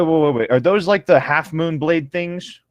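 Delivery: ordinary talk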